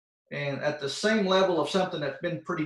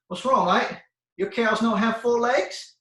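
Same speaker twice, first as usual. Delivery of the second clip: bad Australian accent, pitched up; blunt Aussie quote in a higher, louder voice; terrible Australian accent; 6 semitones higher, 4 dB louder than usual. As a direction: put on a clumsy Australian accent. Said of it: "in my terrible Australian accent".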